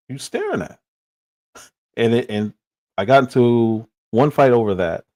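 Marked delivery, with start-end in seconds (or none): 0.1-0.8 high, mocking challenge tossed at a stranger; retold challenge. Young man in California challenging someone staring, high taunting tone. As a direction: imitate yourself picking a fight, pitched way up. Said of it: "I would ask them".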